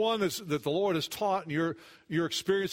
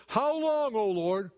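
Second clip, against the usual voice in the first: anguished cry; 4 semitones higher, slower than usual. Acted. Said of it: "David did when he said".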